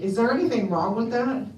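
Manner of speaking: usual speaking voice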